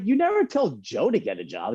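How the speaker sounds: high, sulky accusation; aggrieved complaint. Retold or imitated. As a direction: protest the unfairness. Complaint to mom about brother.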